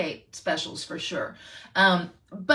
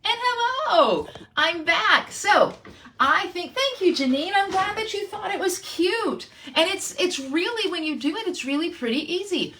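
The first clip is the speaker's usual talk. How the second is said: cheery high greeting; 9 semitones higher than usual. Real, not acted. Bright high greeting back on camera.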